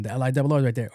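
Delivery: usual speaking voice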